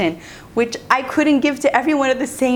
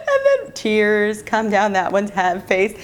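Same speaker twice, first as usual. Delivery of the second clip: plain reading voice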